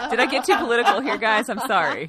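laughing loudly while apologizing. Real, not acted. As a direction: burst out laughing as you speak. Laughing apology about politics.

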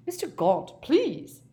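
shocked plea, leaping high at the end. A narrator's reading. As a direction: protest in shock, voice jumping up. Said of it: "said Ogden in a shocked voice".

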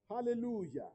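ordinary delivery

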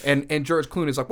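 ordinary talk

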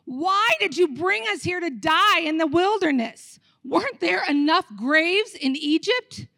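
mock outcry. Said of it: "They cried out to the Lord".

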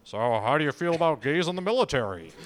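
put-on interviewer's voice; Ted Koppel. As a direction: lightly voice a newsman posing a question. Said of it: "But Koppel asked me".

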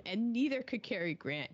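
ordinary delivery

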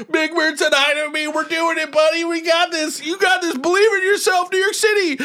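very high, hammed-up tearful pep talk; mock sobbing. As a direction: blubber encouragement in a squeaky wail. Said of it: "Like, crying so hard".

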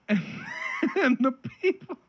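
laughing while reading; words breaking into laughter. Real, not acted.

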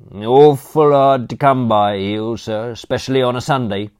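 high, loud cockney manservant offering; chirpy servant barging in with condiments; cheeky butler. Acted. Butler Crawshaw to Aunt Agatha, loud chirpy cockney offer.

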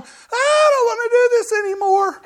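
high, loud mock wail of terror; panicking kid. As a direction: shriek it like a child backing out at the top. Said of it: "they get to the top and they're going".

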